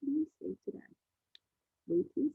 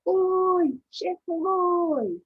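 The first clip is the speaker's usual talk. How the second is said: high, very loud, stretched-out call; shouted hail; 7 semitones higher, 13 dB louder, much slower than usual. Shown as a demonstration. Teacher leading children as sailors, loud drawn-out shout. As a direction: lead the children in a long shout. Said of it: "Can you shout that with me?".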